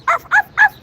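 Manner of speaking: high yappy arf arf arf; squeaky pretend dog barks; mock dog barking. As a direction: bark like a little dog, mocking.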